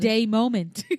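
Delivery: loud, laughing through the words. Real, not acted. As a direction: crack up while saying it. Cracking up over her day.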